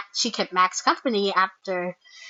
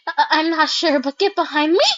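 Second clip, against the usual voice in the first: higher, stammered warning; stammer, then push out a protective order; mild panic, acted; alarmed; 6 semitones higher, 4 dB louder than usual. Acts alarmed character facing smoke.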